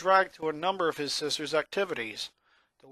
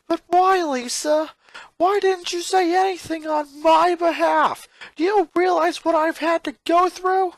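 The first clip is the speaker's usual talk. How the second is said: put-on much higher, louder boy shout; upset shout; 8 dB louder than usual. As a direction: demand answers in distress. Lincoln shouting at sister.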